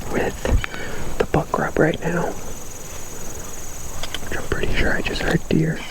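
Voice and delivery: barely whisper, deer are close. Real, not acted. Whispering after hearing deer.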